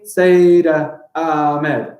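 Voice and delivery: very slow, drawn-out "Sayyida Ahmed"; deliberate counting-out of "Sayyida Ahmed"; syllable by syllable. Shown as a demonstration. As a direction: stretch each beat of "Sayyida Ahmed". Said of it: "I got four there".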